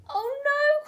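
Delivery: high, loud cry of dismay. A narrator's reading. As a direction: cry out as the shop is shut. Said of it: "cried George".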